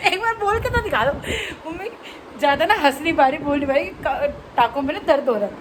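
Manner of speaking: usual speaking voice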